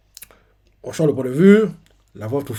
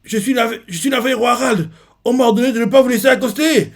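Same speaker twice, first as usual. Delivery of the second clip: marine warning; 10 semitones higher, 4 dB louder than usual. Retold or imitated. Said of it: "un marine lui dit ça".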